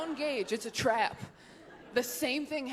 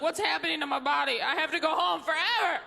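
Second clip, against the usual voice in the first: put-on quick, higher panicky boy voice; overwhelmed young boy; 5 semitones higher, faster than usual. Comic plays a boy shocked by his body, panicky. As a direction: play a boy in a rush of confusion. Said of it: "I felt like a young boy".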